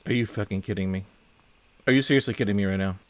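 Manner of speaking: usual speaking voice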